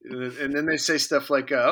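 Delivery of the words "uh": normal talking voice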